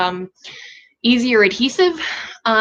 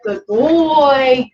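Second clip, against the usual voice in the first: dog praise; 3 semitones higher, 5 dB louder than usual. Real, not acted.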